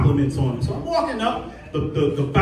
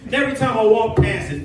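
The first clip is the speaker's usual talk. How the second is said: ordinary delivery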